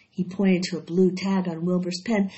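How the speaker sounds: plain reading voice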